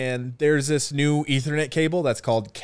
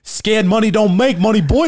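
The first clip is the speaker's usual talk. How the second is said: swaggering catchphrase; 5 semitones higher, 5 dB louder than usual. Retold or imitated. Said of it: "What is the saying".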